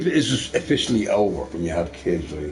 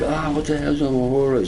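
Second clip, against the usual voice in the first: puzzled; 6 semitones higher than usual. Retold or imitated. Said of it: "I'm like".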